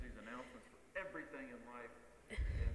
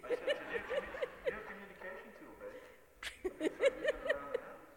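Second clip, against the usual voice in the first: laughing through the words; 13 semitones higher, 6 dB louder than usual. Real, not acted. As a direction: crack up while talking. Laughing while retelling the prank.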